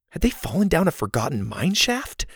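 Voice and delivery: ordinary delivery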